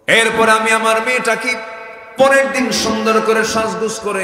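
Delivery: loud, high chanted storytelling; full-force preaching. Acted. Father telling of dressing his daughter up, full-force preaching. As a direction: raise the tale to a preacher's chant.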